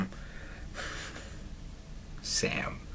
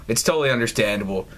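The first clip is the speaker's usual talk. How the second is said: ordinary talk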